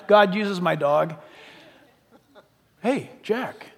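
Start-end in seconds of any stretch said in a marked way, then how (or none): 2.8-3.7 soft, playful voice of a little dog; talking dog voice. Pretend. Preacher voicing his little dog waking him, soft playful dog voice. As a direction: play the pet dog nudging its owner awake. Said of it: "if your poodle said that to you".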